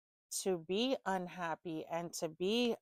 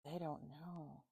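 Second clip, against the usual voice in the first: much softer repeat, almost to herself; quiet; 3 semitones lower, 13 dB quieter, slower than usual. Real, not acted. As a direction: murmur it to yourself. Repeats line, then softer.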